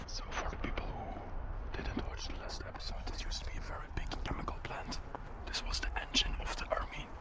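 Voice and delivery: hushed explainer whisper. Real, not acted. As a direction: whisper it, guards are close. Whispering near guards.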